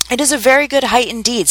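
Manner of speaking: high-pitched narrated indignation; angry. A narrator's reading. The caterpillar, three inches high, defends its height, angrily. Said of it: "said the caterpillar angrily".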